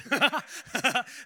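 high, loud burst of laughter; laughing. Real, not acted. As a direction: burst out laughing at your own joke. Laughs at being stuck in airport.

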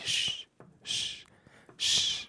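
long voiceless shh; imitated librarian shushing. Librarian to students laughing during a test, imitated shushing. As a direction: hush the room like a librarian. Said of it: "the librarian's over there like".

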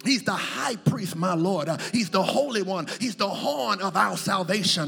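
loud, rhythmic litany gathering force; building preaching. Real, not acted. Preacher listing names of Jesus to the church, building fervor.

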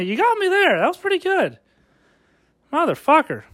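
hammed-up sore loser; mock grudging concession. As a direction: concede to the rival with a mock grin.

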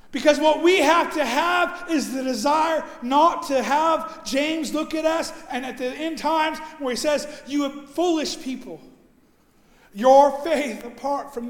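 loud, driving pulpit delivery; preacher stirring the congregation; full-force preaching. Acted. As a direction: preach it out with conviction.

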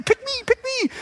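high-pitched singsong squeak; imitated kids. Everyone's calling mom by the name, high kid voice. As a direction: chirp it like preschoolers.

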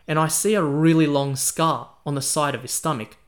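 usual speaking voice

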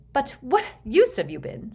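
high, scornful question; sneering. A narrator's reading. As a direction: challenge with an open sneer. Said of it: "sneered Bellatrix".

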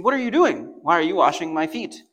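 high, loud cry of disbelief; a loud, shocked high protest; incredulous protest. Retold or imitated. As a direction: Peter can't believe what's happening. Peter protesting to Jesus.

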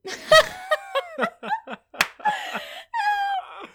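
high squealing burst of laughter; loud shrieking laugh. Real, not acted. Corey's reply cracks her up.

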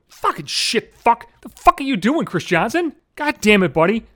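re-enacted angry outburst at himself; exasperated self-scolding. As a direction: curse yourself out after a painful bump. Acts out cursing himself after hitting head.

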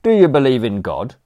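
high, loud cockney manservant offering; chirpy servant barging in with condiments; cheeky butler. Acted. Butler Crawshaw to Aunt Agatha, loud chirpy cockney offer.

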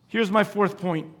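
ordinary talk, nothing marked

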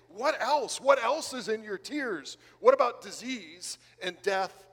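pushing. Acted. Apostle pushing a friend to name every sorrow, insistent. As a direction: press them question after question. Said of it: "Paul might push you and say".

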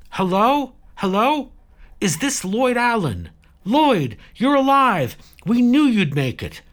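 loud, very high excited cries; thrilled. A narrator's reading. Saradwan learning over the radio Lloyd is alive, thrilled. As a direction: burst out with delighted recognition, pitch soaring.

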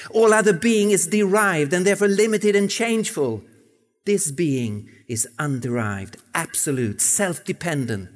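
measured, declaiming quote read in English; a hint of old-fashioned pulpit oratory; formal sermon reading. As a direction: read it like a solemn preacher.

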